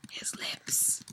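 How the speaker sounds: breathy whispered reread of the words. Real, not acted. Reading fanfic aloud to a friend, giggly whisper. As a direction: hush it, like a giggly aside to a friend. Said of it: "I'm drunk".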